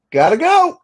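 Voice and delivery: high mock mother rushing him off; mother imitated. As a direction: squeak it out like a flustered mom. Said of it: "she just kinda goes".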